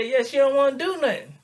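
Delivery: loud, high griping; worked-up complaint. Acted. Friend complaining about the girl, worked up.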